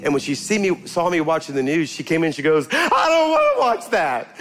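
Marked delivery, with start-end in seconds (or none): 2.7-4.4 put-on high whiny child's voice; little girl voice. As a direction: whine it like a small girl. Granddaughter voiced in story.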